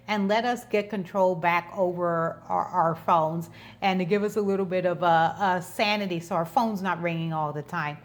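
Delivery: ordinary talk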